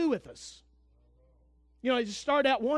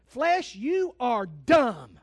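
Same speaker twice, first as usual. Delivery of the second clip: slow, loud shout scolding his own flesh; 6 dB louder, much slower than usual. Retold or imitated. Preacher rebukes his own flesh, slow loud shout. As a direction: bark it out slowly at yourself. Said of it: "After a while I say".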